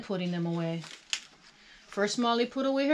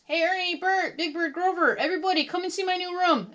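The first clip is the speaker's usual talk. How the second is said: high, eager summons; calling out; 10 semitones higher than usual. A narrator's reading. Molly calls friends from her stoop, calling out. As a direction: hail each friend from afar. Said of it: "called out to her new friends".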